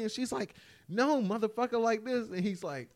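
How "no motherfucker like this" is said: imitated high-pitched exasperation; mock fed-up wife, pitched up; exasperated instructing. Imitating wife showing husband how to mix, exasperated.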